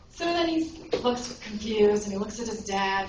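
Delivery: usual speaking voice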